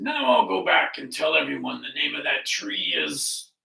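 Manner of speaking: loud, resolute lion voice; freshly woken and booming; confident lion. Acted. Lion resolves to tell everyone, loud and confident.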